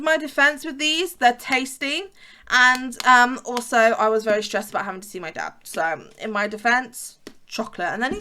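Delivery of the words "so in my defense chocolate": ordinary talk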